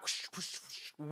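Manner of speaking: breathy whoosh noise for cars weaving; whooshing car sound. Retold or imitated. Storyteller mimics car in mirror, soft whooshes. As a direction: imitate passing cars, whispered.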